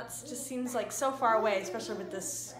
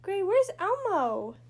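excited; 12 semitones higher, 14 dB louder, much slower than usual. Real, not acted. Excited game with toddler.

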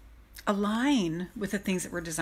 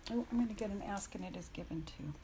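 muttered aside; 12 dB quieter, faster than usual. Real, not acted. Muttered aside opening cards.

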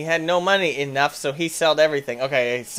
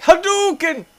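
high, loud fireball yell; fighting game shout; 6 dB louder, slower than usual. Retold or imitated. Friend pushing Bobby, mock special move yell. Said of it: "He's like".

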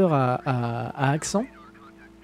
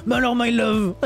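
higher, put-on accent; Poipois; 5 semitones higher than usual. Streamer showing how a beloved friend talks, higher imitated accent. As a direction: affectionately mimic a friend's catchphrase. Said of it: "j'adore Poipois quand il parle comme ça".

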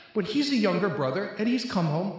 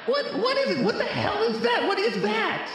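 startled shout. Real, not acted. Comedian startled by sudden noise, high alarmed shout.

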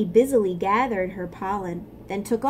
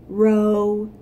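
croaking frog; 7 dB louder than usual. Acted. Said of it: "croaked the big frog".

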